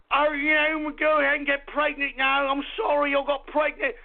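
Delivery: high, hammed-up New Zealand caricature; mock New Zealand accent. Host mocking the pregnant prime minister, put-on New Zealand accent. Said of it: "the New Zealand Prime Minister".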